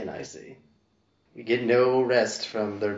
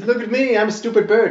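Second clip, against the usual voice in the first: high, louder fake bird voice; 10 semitones higher, 6 dB louder than usual. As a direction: squawk it like a silly bird. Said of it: "I say it in a voice that's not my voice".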